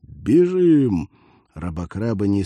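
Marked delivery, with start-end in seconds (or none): 0.0-1.1 loud, high command to run; shouting. Acted. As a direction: yell at the squad to flee. Sergeant orders run.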